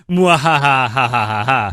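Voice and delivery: mock evil cackle; hammy villain laugh; mad scientist. Evil laugh after "become a god.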